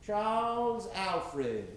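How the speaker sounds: ordinary delivery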